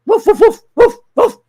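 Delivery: loud, short mock barks; sharp human-made woofs; dog barking. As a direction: bark like a dog in quick bursts. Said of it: "Now I'm barking".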